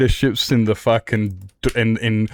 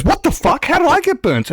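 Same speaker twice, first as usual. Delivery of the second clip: much higher incredulous what the fuck; shocked little brother. Retold or imitated. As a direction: squeal it out like a kid who just got burnt. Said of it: "he gets burnt and he goes".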